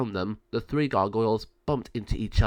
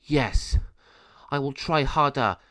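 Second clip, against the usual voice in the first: angry; 4 semitones higher, 5 dB louder, slower than usual. Acted. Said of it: "Penelo became very angry and said".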